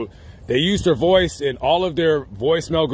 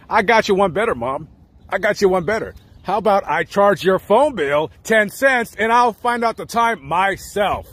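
pitched up, smug little smart-aleck; cheeky comeback; 5 semitones higher than usual. Retold or imitated. Eight-year-old talking back to his mother about the time, cheeky and pitched up. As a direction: sass your mom like a know-it-all eight-year-old. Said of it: "I said".

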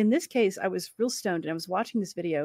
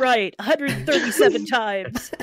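mock director's call, higher and louder; demanding director; 4 semitones higher, 6 dB louder than usual. Director to an actor, mock demanding. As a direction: order another take with playful force.

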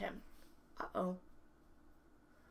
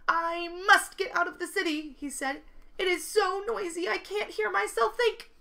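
higher and louder, desperate; fed up and fleeing the noise; loud frantic complaint; 9 semitones higher, 7 dB louder than usual. Acted. Winky fleeing the noisy city.

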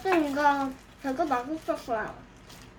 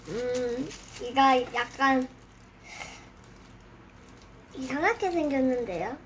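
normal talking voice

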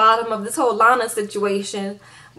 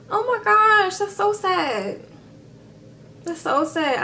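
mock sympathy; 8 semitones higher, slower than usual. Imitating hotel clerk fake sympathy.

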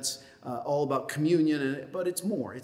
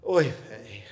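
weary sigh; 7 semitones lower than usual. Retold or imitated.

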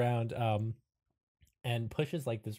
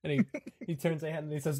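ordinary delivery

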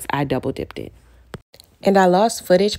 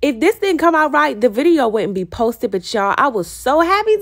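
excited; 9 semitones higher than usual. Real, not acted. Gushing over her finished strawberry.

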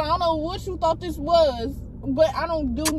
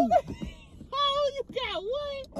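high shocked squeal, laughing; shocked laughing disbelief; 9 semitones higher, 6 dB quieter, slower than usual. Real, not acted. Shocked at the monkeypox news.

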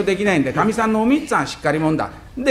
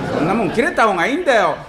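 ordinary talk, nothing marked

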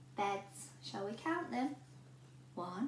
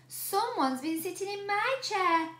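higher mother bear voice; rumbling Mummy Bear; 8 semitones higher, 5 dB louder than usual. A narrator's reading. Mummy Bear grumbling about her eaten porridge. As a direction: grumble like an upset mama bear. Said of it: "rumbled Mummy Bear".

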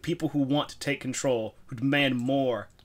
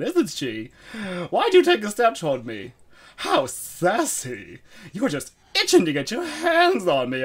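high, showy theatrical diva voice; flamboyant diva; 8 semitones higher, 4 dB louder, slower than usual. Pretend. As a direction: camp it up like a star. Voicing flamboyant game character.

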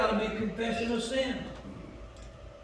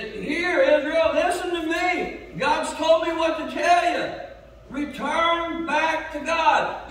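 louder, higher prophet's call; Samuel preaching; 7 semitones higher, 5 dB louder, slower than usual. Retold or imitated. Samuel calling Israel back to God, loud and higher. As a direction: thunder it out as Samuel. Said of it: "He said".

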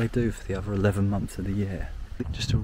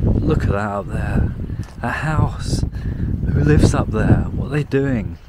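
excited; 3 semitones higher, 8 dB louder than usual. Real, not acted. Spots a house up the hill.

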